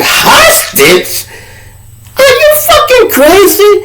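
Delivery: very high shrieked disbelief; shocked outburst. Retold or imitated. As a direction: scream it in total disbelief. Shocked at hostage claim.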